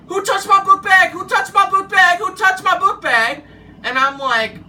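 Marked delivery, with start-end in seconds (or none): 0.1-3.4 loud, high imitation of a furious man yelling; retold angry yelling. Angry coworker demands who moved his bag, mock yelling. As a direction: mimic his yelled demand, pitched way up. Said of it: "he came out yelling".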